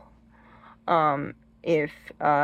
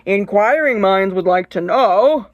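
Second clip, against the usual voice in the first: loud, mock in-your-face reporter; pushy microphone interviewer; 2 semitones higher, 9 dB louder, slower than usual. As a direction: thrust it out loudly, like a mic shoved at someone. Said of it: "putting a microphone in their face and say".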